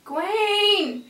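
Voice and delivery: high, drawn-out name; emphatic exclamation. Real, not acted. Insisting on the character's name.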